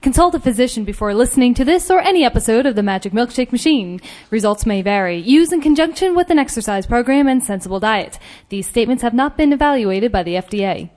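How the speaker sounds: rapid-fire disclaimer read like an ad; fast legal disclaimer. Acted. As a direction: rattle off the fine print.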